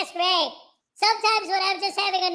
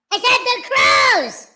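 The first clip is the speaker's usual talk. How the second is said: said as a loud shout, pitched up; 2 semitones higher, 9 dB louder than usual. Shown as a demonstration. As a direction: yell it out loud.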